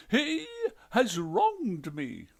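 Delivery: high, loud, slow; aggrieved ghost wife. A narrator's reading. Ghost wife tells the detective her grievance, high, loud, slow.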